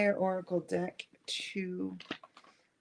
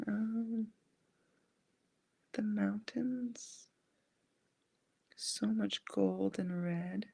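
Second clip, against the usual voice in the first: hushed, slow, describing a vision; channeling the archangel's imagery, slow and hushed; slow visionary whisper; slower than usual. Real, not acted. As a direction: whisper slowly as if seeing it.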